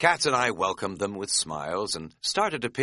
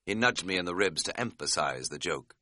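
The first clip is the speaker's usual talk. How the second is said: usual speaking voice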